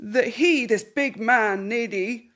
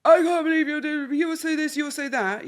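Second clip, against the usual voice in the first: higher, louder mock accusation; angry husband; 6 semitones higher, 3 dB louder than usual. Husband storming in and ranting at his wife, mock angry. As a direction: rant at her like he's fuming. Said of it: "he's going".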